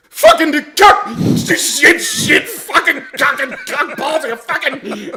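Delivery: hammed-up sputtering rage; mock cursing fit. Dad cursing at the couch downstairs, mock furious.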